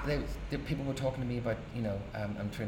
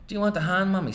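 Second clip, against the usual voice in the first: put-on a boy's voice pitched higher and louder; eager little boy; 10 semitones higher, 8 dB louder than usual. Young son offering to help his mother, higher eager voice. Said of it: "says me".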